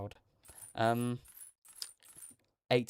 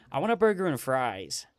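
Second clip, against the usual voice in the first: American accent; 2 semitones higher, 4 dB louder than usual. Shown as a demonstration. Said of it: "try and do it in my American accent".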